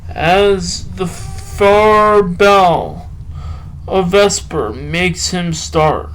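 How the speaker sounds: slow, measured poetry reading with long pauses; poem recital. Acted. Reading a Byron poem to listeners, slow recital. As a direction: let each line breathe like a recital.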